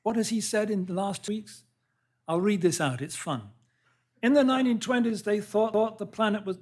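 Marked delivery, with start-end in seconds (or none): 4.2-6.6 high, loud attempt at an American accent, abandoned; failed American accent. Lecturer quoting Trump, a failed American accent. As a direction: ham up a Trump-style American accent and give up mid-line. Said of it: "I can't do an American accent".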